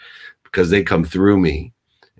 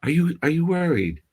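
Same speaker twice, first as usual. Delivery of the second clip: a hint of a caring nurse checking on a scared father; gentle nurse voice; 9 semitones higher than usual. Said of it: "She goes".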